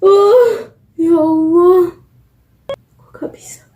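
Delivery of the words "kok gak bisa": soft whispered question to herself. Real, not acted. Vlogger puzzling to herself between reading, whispered. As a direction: breathe it almost silently. Muttering to herself mid-read.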